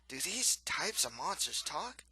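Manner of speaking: slow storybook thug wondering aloud; puzzled monster hunter. A narrator's reading. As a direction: ask it slowly in a character voice. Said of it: "One of them asks aloud".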